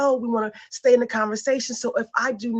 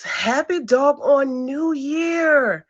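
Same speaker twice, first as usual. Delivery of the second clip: drawn out; 3 semitones higher, much slower than usual. Real, not acted. Host greets live viewers.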